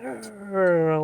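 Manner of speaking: high-pitched mock dog noise; imitated dog growl. Dog jumps up behind its owner, growling. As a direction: voice the dog's outburst.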